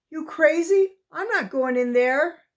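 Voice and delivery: disbelieving, voice jumping up; you can't be serious; incredulous. A narrator's reading. Boy refusing to enter trap.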